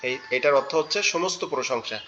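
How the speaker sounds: normal talking voice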